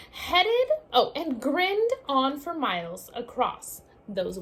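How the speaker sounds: lively storybook read-aloud; reading to children. Acted. Teacher reading a picture book to her class, lively read-aloud. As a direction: read it out like story time for little ones.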